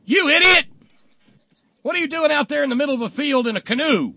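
high, loud yell across a field; indignant long-distance shout; angry yell. Acted. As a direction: holler at a fool from the roadside. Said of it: "gets out and yells".

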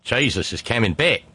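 hammed-up Australian protester; mock Australian proclamation; terrible Australian accent. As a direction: put on a broad, bad Aussie accent. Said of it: "my terrible Australian accent".

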